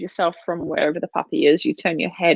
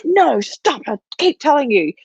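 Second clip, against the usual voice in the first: high, scolding owner voice imitated; mock frustrated owner; 7 semitones higher than usual. Mocking owners who scold their biting puppy, high nagging voice. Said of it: "so you start".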